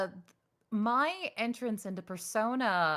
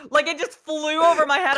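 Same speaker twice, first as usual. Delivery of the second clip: louder, laughing through it; 7 semitones higher, 11 dB louder than usual. Real, not acted. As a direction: laugh it off, amused at yourself. Laughing at her mix-up.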